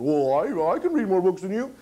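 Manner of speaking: Rudy's retort. Acted. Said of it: "He said".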